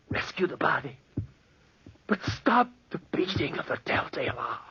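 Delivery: whispered confession; broken whisper. Acted. Charles confessing to the inspectors, broken whisper. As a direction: whisper it, spent.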